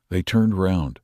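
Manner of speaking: ordinary delivery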